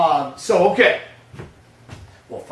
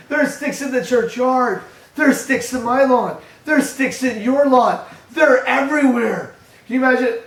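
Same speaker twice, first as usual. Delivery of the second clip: amused amazement; 10 semitones higher, 11 dB louder than usual. Acted. Preacher to congregation, marvelling at the endless sticks, loud and high. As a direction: pile it on like it's a wonder of the world.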